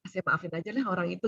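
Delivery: very quiet, murmured to oneself; inner voice. Acted. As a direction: say it under your breath, as a private thought. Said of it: "Atau dalam hati".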